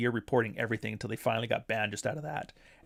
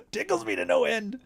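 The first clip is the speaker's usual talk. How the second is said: high, giggling repeat of tickles me to no end; laughing delight; 13 semitones higher, 3 dB louder than usual. Real, not acted. Amused by the guests' arguments, laughing high. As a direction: squeal it out through a laugh.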